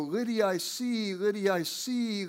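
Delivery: slow, lightly voiced chant of an old church sister; slow repeated chant in a mild put-on voice; imitating church lady. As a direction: drawl the phrase like a praying church lady. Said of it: "Sister Fry would always go".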